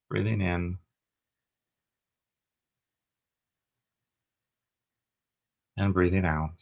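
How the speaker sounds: calm meditation guide with long pauses; guided meditation. Acted. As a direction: guide them gently through each breath, leaving space between phrases.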